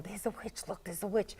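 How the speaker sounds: quiet, retold aside to children; hushed pointing-out. Father on the trolley pointing a witch out to his children, hushed.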